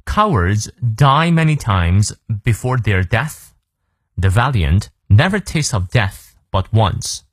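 slow, measured model reading in English; slow read-through. Shown as a demonstration. Teacher runs through the whole quote for learners, slow model reading. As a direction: take it slowly from start to end. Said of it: "从头到尾我们来过两遍".

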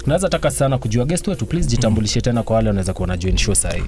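ordinary talk

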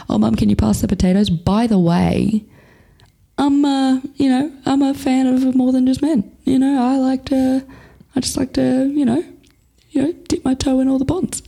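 high, singsong faux-casual voice; mock-casual coming out. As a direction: hedge it awkwardly in a high mock-innocent pitch. Imagined coming out over dinner.

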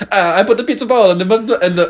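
mock Italian accent; high mocking Italian accent. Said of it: "it's just mimicking".

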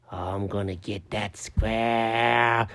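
low, slow menacing whisper; dark other voice. Acted. [name] turned dark, threatening Chuck, low menacing. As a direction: growl it from a dark place. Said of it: "that's my voice".

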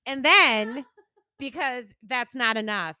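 usual speaking voice

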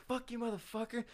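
high-pitched mock cussing out; retold insult. Acting out the quarrel's insult.

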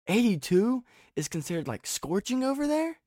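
high-pitched incredulous question; retold disbelief. Retelling own disbelieving reply.

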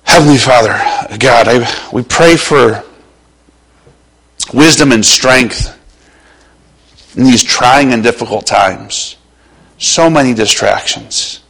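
closing prayer, hushed. Acted. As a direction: pray it low and humble.

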